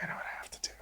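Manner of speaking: barely audible retold whisper; hushed, breath-only imitation of a grave reply; whispered resolve. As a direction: whisper it as a solemn acceptance. Retold hushed reply in final moment.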